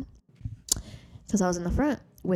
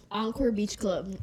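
ordinary talk

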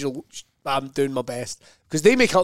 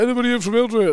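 higher-pitched impression of a compere calling out; mimicked opening question to an audience, pitched up; club compere; 8 semitones higher, faster than usual. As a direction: imitate an act working the crowd. Same crowd question imitated again.

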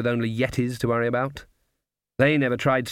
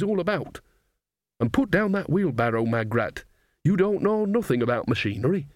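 put-on raised-pitch, emphatic old witch telling a friend off; scolding witch Nanny Og; 3 semitones higher than usual. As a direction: lecture your fellow witches in a lilting voice.

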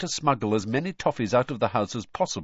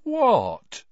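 high, louder, unconvincingly innocent query; feigned innocence; 8 dB louder than usual. A narrator's reading. Son caught by his mother, falsely innocent. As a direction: play innocent and fool nobody. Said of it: "in an innocent tone that deceived nobody".

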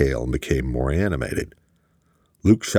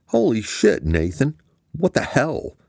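shocked outburst; 8 semitones higher than usual. A narrator's reading. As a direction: recoil at the news, "What the hell?" climbing high. Boss shocked by assassination plan.

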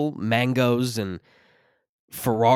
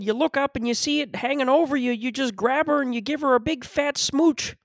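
Jordan Peterson impression; 13 semitones higher than usual. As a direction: preach tradition in a high, pinched lecturing voice. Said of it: "I feel like Jordan Peterson".

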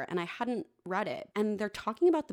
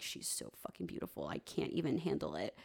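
awed hush; 11 semitones lower, 7 dB quieter than usual. Retold or imitated. Hero overwhelmed by her beauty.

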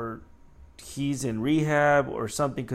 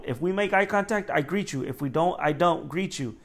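ordinary delivery

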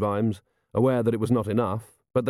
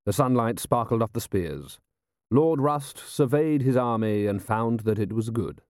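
ordinary delivery, nothing marked

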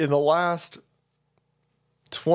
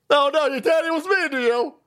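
mock whine; 8 semitones higher than usual. Mocking Will's self-pity.

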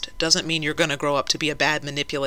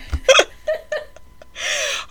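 breathy silent laugh. Real, not acted. Still laughing at the joke.

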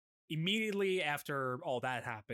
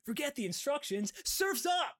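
high-pitched yell on the verge of breaking; Dub Taichi impression; 12 semitones higher than usual. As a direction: push it way up high, like a voice about to crack. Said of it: "his voice is on the verge of breaking".

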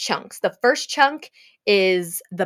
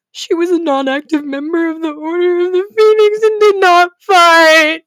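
outraged disbelief; 9 semitones higher, 8 dB louder than usual. Real, not acted. Outraged reading the fanfic ending.

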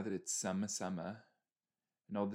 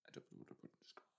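soft unvoiced whisper of "I don't know what to put in scotch"; 22 dB quieter, much faster than usual. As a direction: breathe "scotch" out in a secretive whisper. Voicing game dialogue twice.

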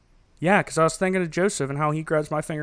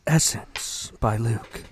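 slow, soft perfume commercial read; low-key luxury ad tagline; 5 semitones lower, 4 dB quieter, slower than usual. Pretend. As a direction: draw the brand name out like a glossy fragrance ad. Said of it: "make it sound like one of those".

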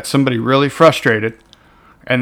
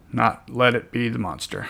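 much softer echo, same words; quiet repeat; 8 dB quieter than usual. Shown as a demonstration. Coach softly repeating his anger slogan to viewers, hushed. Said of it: "repeated slogan".

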